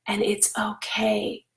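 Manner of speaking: whispered "and it's okay", slow and gentle; soft whisper. Real, not acted. Mom reassuring parents that big feelings are okay, whispered. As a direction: let it fall to a hush.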